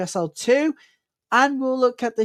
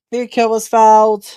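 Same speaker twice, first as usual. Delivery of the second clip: loud call; 10 dB louder than usual. Real, not acted. Calling a foul live.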